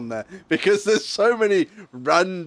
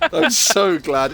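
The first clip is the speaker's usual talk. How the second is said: drawn out, louder and higher; gleeful; 7 semitones higher, 7 dB louder, slower than usual. Real, not acted. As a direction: savor every word slowly. Repeats line 38 with emphasis.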